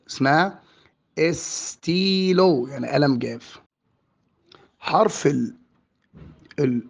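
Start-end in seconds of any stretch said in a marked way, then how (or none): 1.2-2.7 استيلو very slow and higher, "es-tiii-looo"; long ee vowel. Shown as a demonstration. Teacher modelling the French word, استيلو drawn out slowly. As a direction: stretch the "ee" in استيلو. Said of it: "ولكن ينطق إي".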